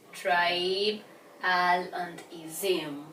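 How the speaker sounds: "Tribe, al, and ism" in slow, separate parts; syllable by syllable. Shown as a demonstration. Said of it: "So for a syllable".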